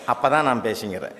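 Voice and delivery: usual speaking voice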